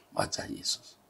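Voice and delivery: hushed whisper of the name in awe. Shown as a demonstration. Preacher repeats Jesus's face to the congregation, whispering. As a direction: breathe it as an awed whisper.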